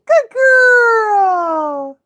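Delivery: high, loud praise for the dog. Real, not acted. Owner praising her dog after the fetch, high gushing praise. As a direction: gush over the dog.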